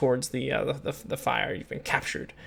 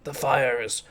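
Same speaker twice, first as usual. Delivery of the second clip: lightly voiced orc. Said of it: "one of the orcs says".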